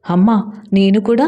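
plain reading voice

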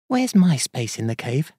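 lightly voiced little mouse; Mouse's voice. Mouse asking Bear for her place, in a small voice.

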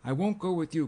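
high, loud refusal; distraught outburst; distressed cry. A narrator's reading. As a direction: cry out in frightened protest. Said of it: "cried the girl".

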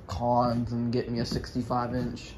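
ordinary talk, nothing marked

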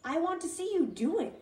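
can't wait to watch, keen and bright; excited tadpole begging to watch; excitedly. A narrator's reading. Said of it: "the pollywog said excitedly".

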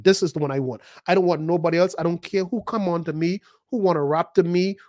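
normal talking voice

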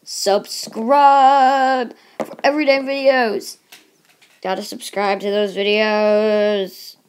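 loud, drawn-out mock announcer call; hammed-up announcement. Vlogger urging viewers to subscribe, hammed-up shout. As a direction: belt it out slowly, stretching the words.

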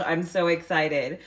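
usual speaking voice

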